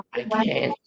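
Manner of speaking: high, anguished refusal; strained protest in a character voice; Starscream. Acted. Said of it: "Starscream is like".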